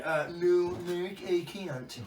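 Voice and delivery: drawn-out, mock-mournful no; slow mock-glum reading. Reading his text reply back to Mark, slow and mock-glum. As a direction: stretch it out like a sad refusal. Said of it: "That's how I read it to him through my text".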